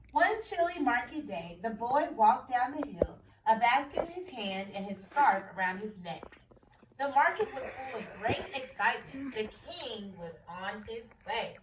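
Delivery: expressive picture-book read-aloud; story reading. A narrator's reading. Teacher reading a picture book to her class.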